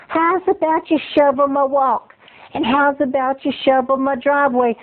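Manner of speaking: cajole with genteel Southern charm; Southern lady. Acted. Southern neighbor bribes boy with penny, drawling. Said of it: "Southern hospitality".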